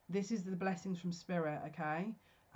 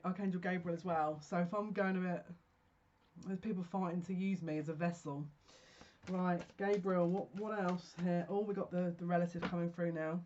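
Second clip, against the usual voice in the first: normal talking voice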